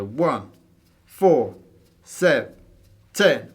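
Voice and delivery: spaced and accented one, four, seven, ten; slow counting of only the dotted beats; counting main beats only. Shown as a demonstration. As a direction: pause between each big beat. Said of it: "feeling those dotted quarter notes".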